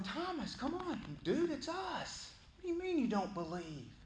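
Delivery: pleading friends. Acted. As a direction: whine at Thomas to believe. Disciples pleading with doubting Thomas.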